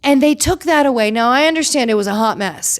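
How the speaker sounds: ordinary talk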